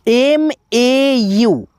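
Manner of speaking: very slow, letter by letter; drawn-out letter names; slow spelling. Shown as a demonstration. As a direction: spell it out slowly for the class. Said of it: "teacher spells letters out".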